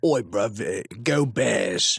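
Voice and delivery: rough London Cockney voice; Cockney accent. Retold or imitated. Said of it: "Did you do a Cockney accent?".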